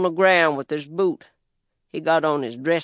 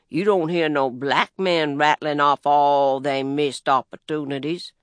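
plain reading voice

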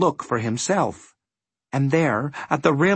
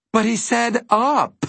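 high, slow protest; bitter complaint; 10 semitones higher than usual. A narrator's reading. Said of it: "Milo complained bitterly".

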